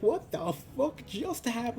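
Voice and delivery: high-pitched, stunned exclamation; mock-shocked high voice; acted shock. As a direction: act out stunned disbelief, pitch jumping up. Kissed awake, acts out shock.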